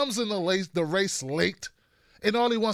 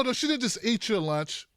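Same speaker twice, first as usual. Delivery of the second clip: usual speaking voice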